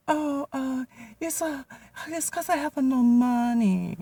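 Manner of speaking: very high, squeaky put-on voice; the boss's wife brushing it off in a falsetto; mock Jenny voice. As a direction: squeak it out like a dainty lady.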